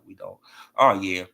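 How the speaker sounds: ordinary talk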